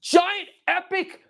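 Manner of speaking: high, slow, stretched-out introduction; mock fight announcer. Host introducing the next toy battle, mock announcer. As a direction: draw out every word to build up the contender.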